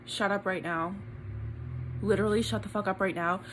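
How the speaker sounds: breathless, near tears; can't believe it; stunned disbelief. Real, not acted. Reading result, disbelief.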